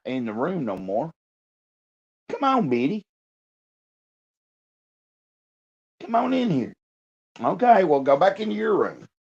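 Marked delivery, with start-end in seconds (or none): 2.3-9.1 high coaxing pet voice; calling the dog. Real, not acted. Owner coaxing his dog into the room, high baby talk. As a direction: coax the dog in, pitched way up.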